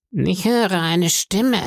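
high, slow, sing-song whine of a blind beggar; eerie sing-song beggar. A narrator's reading. Blind beggar begging the innkeeper's boy, high sing-song voice. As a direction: lilt each phrase upward like a pitiful plea. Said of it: "sagte der Blinde".

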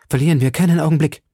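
rushed alarmed call to flee; urgent cry. A narrator's reading. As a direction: hurry her, shouting. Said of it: "schrie Milady".

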